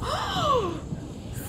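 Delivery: long, high-pitched shocked gasp; horrified gasp. Real, not acted. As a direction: gasp in horror before a wail. Sees Sage dying in game.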